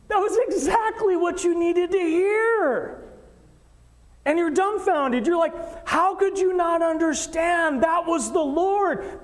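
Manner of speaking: high, loud and urgent, preaching to the congregation; fervent preaching. Acted. Pastor pleading with the congregation, fervent preaching. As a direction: press every point home from the pulpit.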